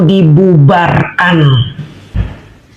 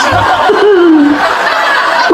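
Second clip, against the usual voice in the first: high, drawn-out gloating cackle; gloating laugh; 10 semitones higher than usual. Real, not acted. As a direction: let out a high, triumphant cackle. Gloats after saying serves you right. (tawa puas mengejek)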